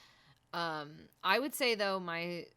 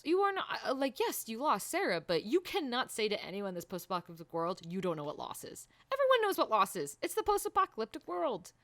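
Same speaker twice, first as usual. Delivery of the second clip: high, louder indignant protest; exasperated rant; 5 semitones higher, 6 dB louder than usual. Real, not acted. Viewer scolding Joel over his loss remark, exasperated. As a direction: argue emphatically, pitch leaping up.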